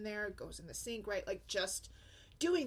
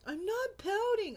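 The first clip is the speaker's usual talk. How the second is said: much higher, louder, slow whine; whiny kid denial; 12 semitones higher, 6 dB louder than usual. Retold or imitated. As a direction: play the pouting daughter. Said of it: "She pouts and whines".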